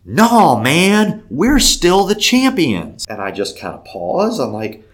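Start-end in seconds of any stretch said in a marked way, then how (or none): 0.0-3.0 loud, high, drawn-out mock indignation; puffed-up defiance. Old man defends his team to a stranger, puffed-up. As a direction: puff up and protest loudly. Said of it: "The guy puffs all up".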